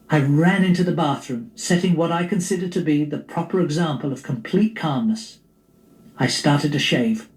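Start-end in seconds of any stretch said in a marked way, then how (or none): none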